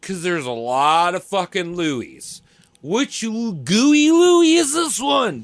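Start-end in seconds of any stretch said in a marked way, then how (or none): 2.8-5.4 put-on high, slow hammy French accent; drawn-out mock French accent. Mock French pronunciation of Louis.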